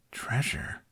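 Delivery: soft breathy whisper of one word. A narrator's reading. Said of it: "he whispered".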